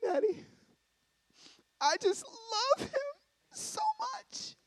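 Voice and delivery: high, squeaky tearful wail, drawn out; mock a lovesick girl sobbing; Hayla. Daughter tearfully confessing her love to dad, high mock crying. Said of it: "And so Hayla said".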